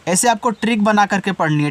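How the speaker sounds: ordinary talk, nothing marked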